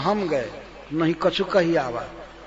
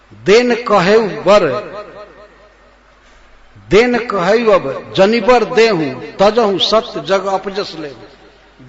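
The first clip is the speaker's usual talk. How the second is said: slow, loud, dragged-out verse; slow loud recital; 2 semitones higher, 8 dB louder, slower than usual. Acted. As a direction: hammer each word at the king. (धीमे, ज़ोरदार स्वर में चौपाई)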